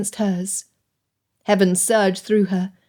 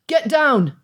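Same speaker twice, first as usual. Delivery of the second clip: shout; 5 semitones higher than usual. A narrator's reading. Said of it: "Mister Croft shouted again".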